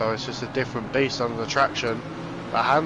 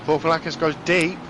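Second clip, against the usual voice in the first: excited race call; 6 semitones higher than usual. Real, not acted.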